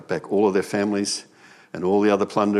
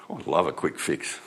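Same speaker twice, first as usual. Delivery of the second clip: laughing; 6 semitones lower, 7 dB quieter than usual. Real, not acted. Laughing aside.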